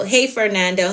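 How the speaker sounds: usual speaking voice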